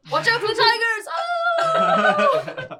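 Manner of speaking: high, loud parrot squawk ending in a drawn-out cry; Bones-mimicking macaw. Macaw repeats its owner's tiger warning, high loud squawk. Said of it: "so they kinda sound like Bones occasionally".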